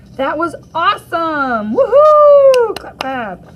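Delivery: loud, high whoop; cheering. Real, not acted. A classmate cheering Myra's film. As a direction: cheer wildly.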